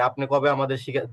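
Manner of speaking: ordinary talk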